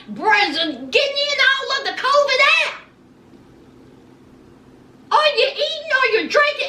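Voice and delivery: high, fast disbelieving questions; incredulous. Retold or imitated. Speaker quizzing the masked couple at the beach, high and incredulous. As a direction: fire questions at them in disbelief, pitched high. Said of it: "I was like".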